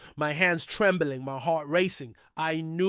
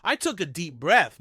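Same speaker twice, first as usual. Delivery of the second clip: usual speaking voice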